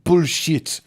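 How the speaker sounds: slow, lightly put-on Belgian action hero; Van Damme impression. Imitating the Belgian policeman as an action star, slow. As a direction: drawl it like a tough action star. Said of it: "like Jean-Claude Van Damme".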